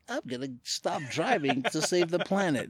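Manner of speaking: pompous save-the-planet pledge, tongue in cheek; mock noble declaration. Mocking a grand reason for giving up his car, lightly pompous.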